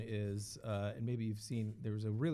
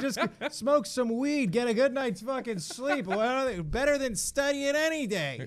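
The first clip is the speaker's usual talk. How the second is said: stoner voice; 10 semitones higher, 10 dB louder than usual. Retold or imitated. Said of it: "I'm like".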